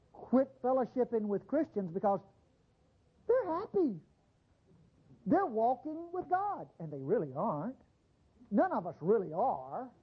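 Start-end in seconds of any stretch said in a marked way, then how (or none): 3.3-6.7 mock happy voice. Mocking the happy Christians.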